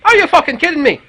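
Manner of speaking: loud, exasperated shout of disbelief; disbelieving yell at the teen mother; outraged yell. Retold or imitated. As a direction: blow up in disbelief at her.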